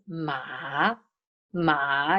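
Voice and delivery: "ma" dipping low, then rising; drawn-out "ma" that sinks and climbs back; low falling-rising tone. Shown as a demonstration. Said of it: "It's low falling rising".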